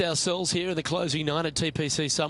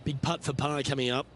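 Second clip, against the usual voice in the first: golf commentator; 2 semitones lower, 5 dB quieter than usual. Acted. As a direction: let the near miss hang. Game golf broadcast call.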